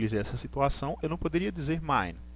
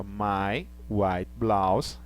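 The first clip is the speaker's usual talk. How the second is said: slow and a little lower; slow model sentence; 6 semitones lower, much slower than usual. Shown as a demonstration. Teacher repeating the model for students, slow. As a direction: repeat it slowly and calmly. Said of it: "Tá certo?".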